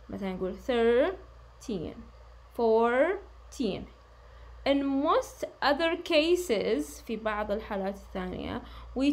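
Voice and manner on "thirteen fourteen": "thirteen", "fourteen" with THIR and FOUR punched; stress on first syllable. Shown as a demonstration. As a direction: lean hard on the opening syllable of "thirteen" and "fourteen". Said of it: "التركيز أو التشديد على ال first". (نبر المقطع الأول في "thirteen" و"fourteen")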